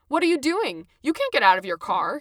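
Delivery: a startled official stopping her; panicked. Acted. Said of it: "he said in panicked tones".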